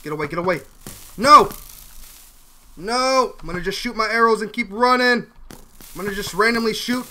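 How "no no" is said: sharp loud cry of dismay. Real, not acted. Player yelling at the screen as he gets hit, loud. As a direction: yell it as you get hit.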